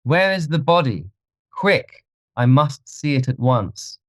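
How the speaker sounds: high-pitched, agitated urgency; urgent demand. A narrator's reading. Dorian clutches at hope, urgent and high.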